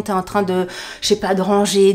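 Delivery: ordinary talk, nothing marked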